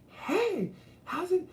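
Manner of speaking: very high, slow, bubbly hey; mock a chirpy LA stranger; high-pitched LA greeting. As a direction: falsetto valley greeting. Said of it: "high pitched like that".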